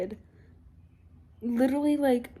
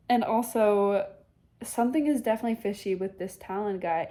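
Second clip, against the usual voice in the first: normal talking voice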